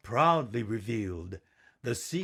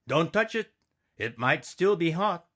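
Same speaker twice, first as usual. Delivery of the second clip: put-on a parent's raised, higher-pitched warning to a child; 6 semitones higher, 3 dB louder than usual. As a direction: warn the child sharply, a little higher. Parent warns child in cabin.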